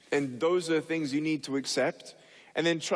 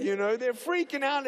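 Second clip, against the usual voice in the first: ordinary delivery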